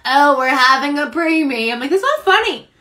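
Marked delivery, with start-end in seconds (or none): 0.1-1.7 tag mocking husband. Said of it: "He's like". 1.9-2.7 high-pitched indignant protest; mock-offended, raised pitch; retold rebuke. Retold rebuke to joking husband.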